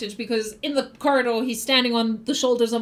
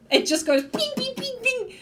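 ping sound effect; 12 semitones higher than usual. Retold or imitated. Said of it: "imitating arrows".

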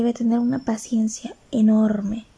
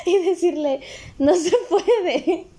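slow, high laughing joke; 8 semitones higher, slower than usual. Real, not acted. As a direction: laugh while saying it. Joking about smacking the client.

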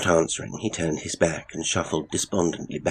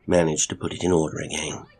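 plain reading voice

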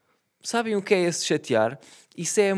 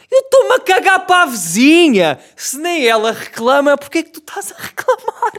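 much higher and louder, exasperated retort; indignant shout; 10 semitones higher, 8 dB louder than usual. Retold or imitated. Son shouting back at father about the neighbour, exasperated yell. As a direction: blow up at him over the neighbour. Son's retort to midnight scolding.

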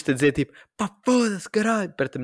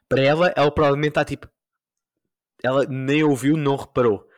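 ordinary delivery

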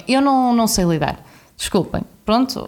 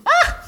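very high squealing shriek with a laugh; fangirl scream. Retold or imitated. Fan spots Pepe Rapazote, high laughing squeal. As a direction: let out a startled fan squeal. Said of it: "soltei assim um grito".

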